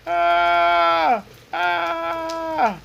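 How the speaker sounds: high, sustained "ah" yell; mock yell. Man on the floor yelling without pain, high mock yell. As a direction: let out a long, high-pitched holler. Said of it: "and then I'm yelling".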